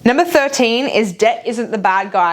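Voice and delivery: normal talking voice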